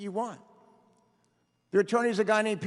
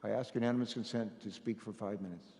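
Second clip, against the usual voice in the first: muffled and much softer; 11 semitones lower, 11 dB quieter than usual. Real, not acted. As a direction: speak through a covered mouth. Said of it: "I can't understand the muffled".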